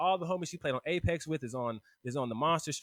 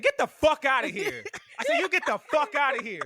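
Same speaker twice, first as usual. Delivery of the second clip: high, loud retold yell; angry outburst; 10 semitones higher, 7 dB louder than usual. He retells blowing up at Whitley, angry outburst. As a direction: act out blowing up at her.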